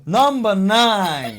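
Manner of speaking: loud, high, drawn-out chant-like yell; stretched-out rowdy holler; shouting. Real, not acted. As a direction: belt it out slowly at full volume. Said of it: "Why they shout?".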